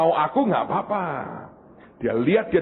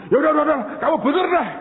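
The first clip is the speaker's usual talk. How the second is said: very fast, higher rush of surrender; exasperated giving in; 9 semitones higher, 3 dB louder, much faster than usual. Pretend. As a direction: give up in a flustered hurry.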